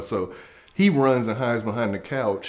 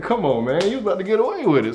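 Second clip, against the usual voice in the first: high, quick, louder pleading; mock exasperation; 9 semitones higher, 4 dB louder, faster than usual. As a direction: complain to the friend who blew it. Exasperated at friend who gave himself away.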